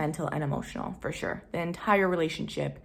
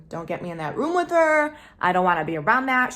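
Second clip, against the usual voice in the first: higher, louder, quick retold rant; mock rant; 3 semitones higher, 7 dB louder, faster than usual. Telling the therapist what she says about his mom, mock loud rant. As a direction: vent it like she's fed up.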